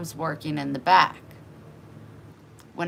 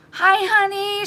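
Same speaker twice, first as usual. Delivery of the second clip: high, sweet mock greeting; sugary neighbor; 7 dB louder than usual. Neighbor barging in, high sugary voice. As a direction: coo it like a fake-nice neighbor.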